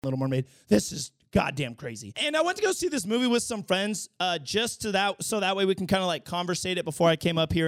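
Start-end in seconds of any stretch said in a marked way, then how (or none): none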